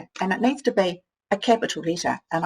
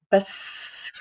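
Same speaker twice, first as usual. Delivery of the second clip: soft voiceless bisc; whispered sounding out; 4 dB quieter than usual. Shown as a demonstration. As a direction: whisper the start of biscuits. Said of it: "try for the second sound".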